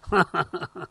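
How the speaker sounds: short amused chuckle; amused laugh. Real, not acted. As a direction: chuckle at your own joke. Laughs at own joke.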